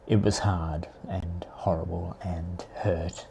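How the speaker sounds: hushed whisper, slow. Real, not acted. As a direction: whisper it as if wincing at the memory. Pained memory of falling on snow.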